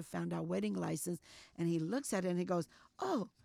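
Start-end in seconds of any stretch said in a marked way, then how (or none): 3.0-3.3 surprised oh. Retold or imitated. Officer finds wedding license.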